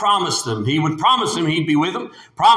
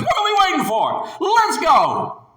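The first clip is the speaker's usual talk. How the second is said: high, loud, fast rallying cry; bold shout; 12 semitones higher, 3 dB louder than usual. Acted. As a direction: push them to go now. Caleb voiced urging boldly.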